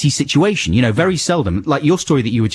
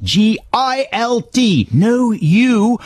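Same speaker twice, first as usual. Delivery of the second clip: G I L T stretched out slowly and high; emphatic spelling out; 11 semitones higher, much slower than usual. Real, not acted. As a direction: spell G I L T letter by letter, drawn out.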